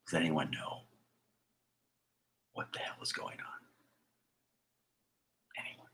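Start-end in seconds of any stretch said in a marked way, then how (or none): none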